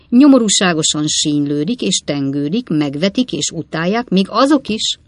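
loud, scornful tirade against the rival paper; contemptuous oratory. Acted. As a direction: thunder it like a pamphleteer. Pott's fiery editorial tirade. (megvető, harsány szónoklat)

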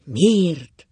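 loud, drawn-out, falling why; emphatic echo with a swelling stress; echoed question. A narrator's reading. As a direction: throw the question back forcefully. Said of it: "kérdezte Ákos is".

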